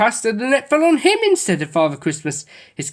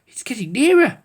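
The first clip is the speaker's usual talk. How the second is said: louder wondering remark; in surprise; 7 semitones higher, 4 dB louder than usual. A narrator's reading. Noddy to his dog, in surprise. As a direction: watch the light come closer. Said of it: "said Noddy in surprise".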